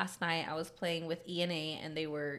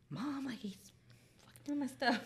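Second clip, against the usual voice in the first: put-on half-whispered, higher little-girl complaint; toddler daughter complaining; 6 semitones higher than usual. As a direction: whisper it like a cross three-year-old tattling. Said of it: "So she's like".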